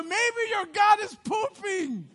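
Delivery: high, drawn-out jeer with a laugh; mock their god, barely holding back laughter; mocking taunt. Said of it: "Elijah starts mocking them".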